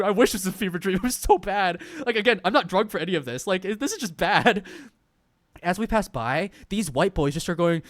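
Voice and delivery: ordinary talk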